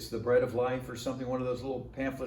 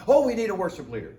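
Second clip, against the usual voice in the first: higher, louder delighted burst; eager pastor; 6 semitones higher, 5 dB louder, faster than usual. Retold or imitated. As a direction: grab the newcomer, thrilled.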